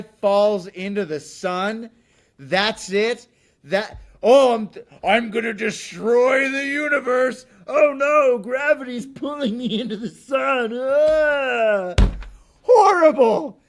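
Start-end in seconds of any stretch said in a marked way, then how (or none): 4.2-10.8 hammed-up doomed villain, higher and louder; a cartoonish mock villain, overacted and loud. Mocking the Green Lantern villain. 10.8-12.0 hammed-up falling-villain scream, high and loud; a cartoon cry as he drops into the sun; short mock death yell. 12.6-13.6 loud disgust. Real, not acted. Disgusted verdict on the movie.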